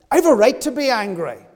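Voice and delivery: loud, high, insistent claim of the right to be angry; angry outburst. Acted. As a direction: stand your ground and shout it out.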